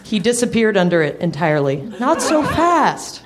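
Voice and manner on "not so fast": high, drawn-out mock protest; insincere urging. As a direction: protest while plainly not meaning it. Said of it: "she urged, entirely insincerely".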